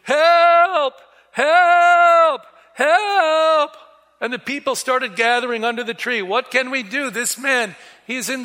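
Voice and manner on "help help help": high, loud calls of help; crying for help. Retold or imitated. Little man yelling for help from the top of a tree. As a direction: shout help down from the treetop. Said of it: "I started crying to the people".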